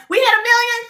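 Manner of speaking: high, loud, thrilled cheer; excited. Real, not acted. Host cheering her live viewers over the sales milestone, excited. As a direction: shout the news to the viewers.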